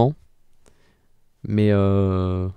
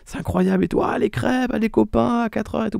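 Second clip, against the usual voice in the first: put-on higher, wide-eyed childlike gushing; gushing child; 10 semitones higher, 3 dB quieter than usual. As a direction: gush like a kid over crêpes. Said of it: "vous vous êtes dit depuis que vous êtes petit".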